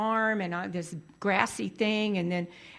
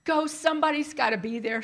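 raised, urgent voice close to tears; tearful pleading; 6 semitones higher than usual. Retold or imitated. Urging Mary Ellen to stay in line, tearful and pleading. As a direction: beg her to stay in line. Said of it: "And I started crying".

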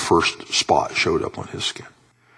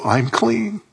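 much higher, amazed declaration; joyful change; 12 semitones higher than usual. Retold or imitated. Preacher voices the leper's change to being clean, high-pitched. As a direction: lift it high as a newly healed man. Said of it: "And then there's a change".

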